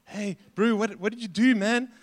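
a mate poking fun, light and cheeky; teasing banter. Retold or imitated. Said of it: "there's a bit of banter".

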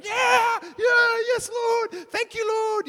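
hammed-up morning praise shout; extreme shout. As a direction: scream your thanks in mock ecstasy. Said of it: "wake up in the morning going".

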